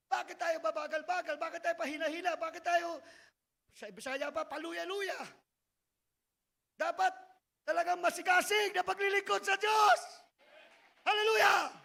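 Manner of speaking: loud exhortation, peaking on Hallelujah; fiery preaching. Acted. Preacher exhorts at full force. (maalab na pangangaral, malakas)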